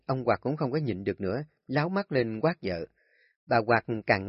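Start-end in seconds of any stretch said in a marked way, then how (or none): none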